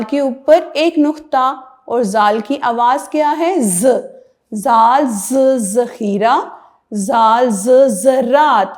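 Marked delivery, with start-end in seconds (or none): 4.5-8.7 put-on خے خ said slowly and clearly for children; slow letter drill. Shown as a demonstration. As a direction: spell it out slowly, letter sound first. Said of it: "letter drill for children".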